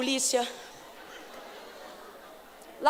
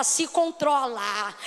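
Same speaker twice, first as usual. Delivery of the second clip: slow, drawn-out se controla; slow demo; 3 semitones lower, much slower than usual. Shown as a demonstration. Preacher models the phrase for the congregation, slowly. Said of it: "vai dizer pra ela".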